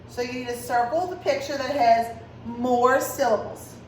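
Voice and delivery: ordinary talk, nothing marked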